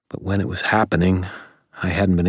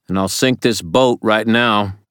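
loud, higher-pitched threat; calling out; 5 semitones higher, 6 dB louder than usual. A narrator's reading. Ebenezer threatening to sink the boat, calling out loudly. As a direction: call the threat across the deck. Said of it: "Ebenezer called".

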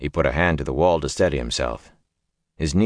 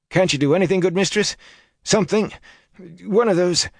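much higher, pleading peasant voice; desperate villager; 13 semitones higher than usual. Acted. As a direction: beg it, pitched up. Simeon pleading.

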